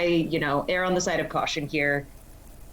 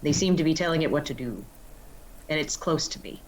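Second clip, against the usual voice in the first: usual speaking voice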